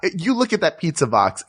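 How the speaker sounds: ordinary delivery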